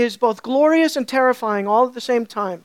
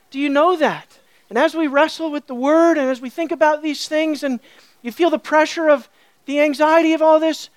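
emphatic, raised preaching voice; impassioned preaching; 4 semitones higher, 4 dB louder than usual. Real, not acted. Preacher urging an anxious congregation to hear, emphatically. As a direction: press the assurance home with force.

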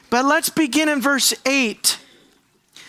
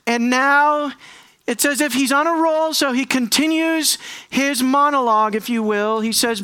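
usual speaking voice

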